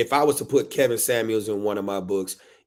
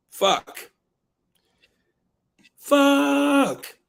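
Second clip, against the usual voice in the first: building frustrated yell; 6 dB louder than usual. Acted. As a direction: curse rising to a high, loud, drawn-out cry. Book's curse builds to a yell.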